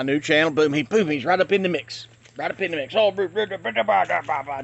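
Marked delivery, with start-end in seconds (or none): 2.9-4.6 mocking blah-blah chatter; mock mouthing off. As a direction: imitate someone yapping away. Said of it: "just popping his mouth off".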